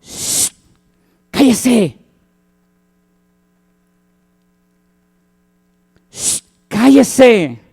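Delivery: hushing someone rudely; annoyed crowd shushing. Retold or imitated. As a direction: tell the beggar to shut up. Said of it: "dice que muchos".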